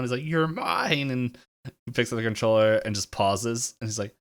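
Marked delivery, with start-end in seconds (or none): 0.2-1.1 higher-pitched possessive outburst; mock meltdown, voice pitched up; freaking out. Game girlfriend claiming her boyfriend, mock freak-out. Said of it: "begins freaking out".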